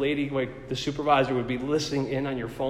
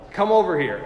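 stern order; 5 semitones higher, 5 dB louder than usual. Retold or imitated. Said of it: "she's like".

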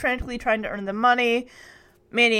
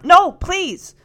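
higher, louder pleading cry; urgent plea; 7 semitones higher, 7 dB louder than usual. A narrator's reading. Michelle grabs her sister's arm, urgently pleading. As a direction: beg urgently to stop someone.

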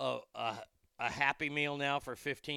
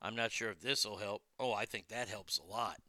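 ordinary talk

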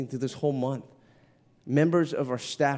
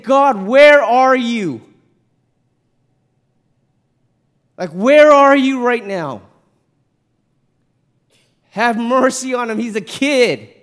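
high, drawn-out shout at heaven; anguished cry to God; 9 semitones higher, 12 dB louder, slower than usual. Retold or imitated. Father pleads to heaven for his hurting son, anguished shout. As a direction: demand an answer, loud and desperate.

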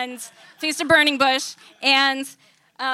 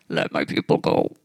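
very low booming Moses voice; deep man voice. Pretend. Joking Moses impression for the congregation, very low. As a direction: drop the voice way down for Moses. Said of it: "That's my deep man voice".

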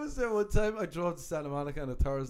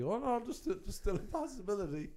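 higher-pitched, mildly flustered backtrack; 6 semitones higher, 4 dB quieter than usual. Retold or imitated. Casting guy caught out by the actor, backtracking. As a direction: mimic someone hastily covering a slip. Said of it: "trying to, like, backtrack".

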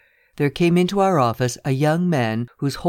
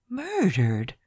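a higher, softer, incredulous echo of the word; stunned disbelief; 5 semitones higher, 4 dB quieter than usual. Acted. Lawyer echoing the secretary's word in soft disbelief. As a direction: repeat "Murdered" as if it can't be true. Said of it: "I could scarcely believe my ears".

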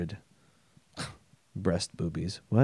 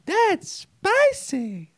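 very high, slow squeal; very high, slow yelp at the spicy drink; squeaky reaction; much slower than usual. Real, not acted. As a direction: squeal it out at the spice.

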